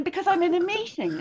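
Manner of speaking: high mock-exasperated husband; husband arguing back with his wife, imitated high and exasperated. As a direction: play the husband defending himself, put upon. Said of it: "And he was saying".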